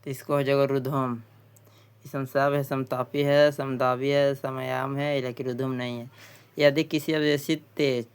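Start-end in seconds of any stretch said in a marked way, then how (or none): none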